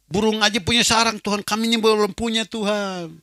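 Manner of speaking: loud, high pleading; pleading prayer. Retold or imitated. Man pleads with God for a home, loudly.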